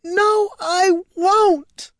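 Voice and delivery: very high, slow wail of refusal; sobbing scream. A narrator's reading. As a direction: wail it high and drawn out through sobs. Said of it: "bursting into a renewed fit of sobs".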